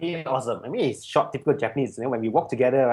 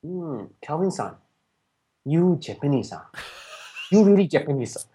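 imitated Japanese accent with long doubting pauses; Japanese-accented English. Japanese friend still doubting the student's origins, imitated Japanese accent. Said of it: "in his kind of English".

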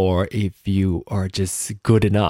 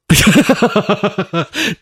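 laugh; 9 semitones higher, 6 dB louder than usual. Real, not acted. Laughing at own slip.

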